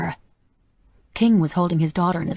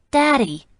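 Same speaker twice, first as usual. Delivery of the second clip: put-on little girl voice; 9 semitones higher, 5 dB louder than usual.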